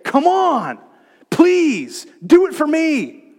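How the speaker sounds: overdone pleading plea; mock pleading. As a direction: plead with them to do it for you. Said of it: "He's not saying to you today".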